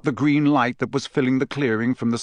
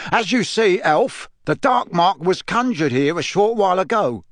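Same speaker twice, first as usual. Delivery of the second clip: higher, pointed interrogation voice; stern official; 7 semitones higher than usual. A narrator's reading. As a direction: press the accusation firmly. Diggory interrogating the elf.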